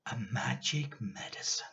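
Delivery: soft, breathy whisper of a secret; conspiratorial whisper. Acted. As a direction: lean in and whisper the magic. George whispers his secret plan.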